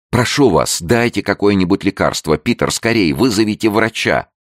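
high, urgent pleading cry; alarmed pleading. A narrator's reading. Woman begs for medicine and a doctor, alarmed pleading. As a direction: plead desperately for help. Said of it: "крикнула Лаура".

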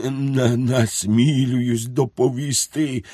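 stammering. A narrator's reading. Cadet nervously reporting to the Lord God, stammering. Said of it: "заїкаючись".

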